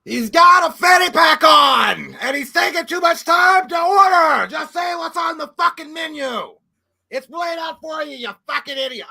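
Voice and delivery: slow, high, explosive shouted movie-star rant; Pacino. Acted. Customer fuming at a slow order in line, shouting Pacino impression. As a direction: bellow it as an exasperated, hammed-up film villain. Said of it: "Pacino from Devil's Advocate".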